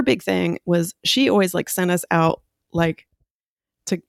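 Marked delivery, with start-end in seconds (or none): none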